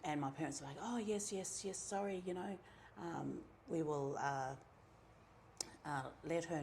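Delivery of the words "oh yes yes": ordinary talk, nothing marked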